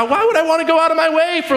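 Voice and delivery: whiny inner voice. Retold or imitated. Voicing his own reluctant thought.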